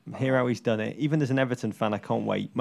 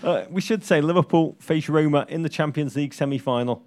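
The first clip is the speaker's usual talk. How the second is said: ordinary delivery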